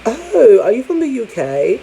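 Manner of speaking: high-pitched, exaggerated curious question; curious questioner. People kept asking about the U K, imitated curious voice. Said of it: "People kept asking me".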